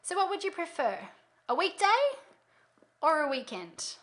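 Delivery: weekday lifted up, weekend dropped down; rising then falling choice. Shown as a demonstration. Coach demonstrating the hostess question, rise then fall. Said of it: "voice goes up".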